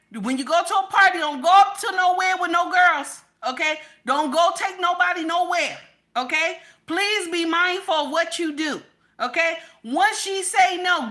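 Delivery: high, loud, emphatic lecture; mother's urgent warning. Acted. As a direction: drill it into your son. Voices mother warning son before college.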